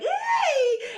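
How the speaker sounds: high, loud squealed yay with a laugh; delighted cheer. Retold or imitated. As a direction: cheer like a starstruck fan. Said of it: "I was like".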